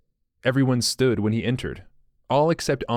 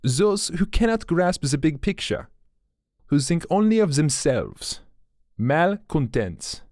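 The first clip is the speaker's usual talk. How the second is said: put-on lightly French-accented apology; a mild French accent, polite; 5 semitones higher than usual. A narrator's reading. Dubois voice.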